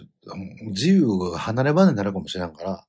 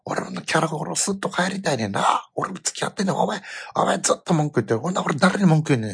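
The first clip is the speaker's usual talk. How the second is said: higher, heated scolding rant; exasperated rant; 7 semitones higher than usual. Retold or imitated. Father venting at his son in the crowd, heated rant. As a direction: let the frustration pour out at him. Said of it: "もうものすごい声で".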